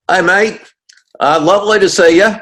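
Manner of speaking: loud, high, hammed-up Aussie accent; big cheerful Australian mate voice, cranked up. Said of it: "your best Aussie accent".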